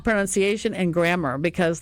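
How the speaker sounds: normal talking voice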